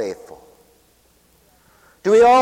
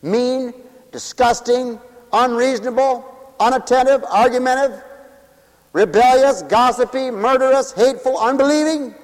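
preaching; 5 semitones higher, 4 dB louder than usual. Acted. Preacher lists sins, affirms mercy.

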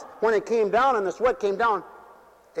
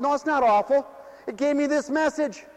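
full-force preaching; 9 semitones higher, 4 dB louder than usual. Acted. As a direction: preach it out with hallelujahs.

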